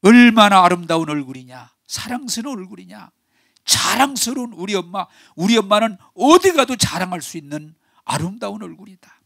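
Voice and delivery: high, rising preacher's fervor; fervent preaching. Acted. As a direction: lift the congregation with praise. 설교 절정에서 높여 외침. (높고 열정적인 설교 목소리)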